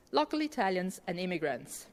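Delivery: ordinary delivery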